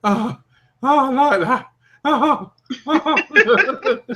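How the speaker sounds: high, mock-pleased oohs; mock delighted. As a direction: act thrilled to be beaten up. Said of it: "you should have gone like this".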